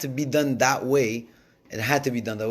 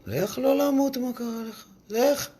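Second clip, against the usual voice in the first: much higher, pleading angel voice; high protesting angel; 12 semitones higher, faster than usual. Acted. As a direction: cry out against letting him live.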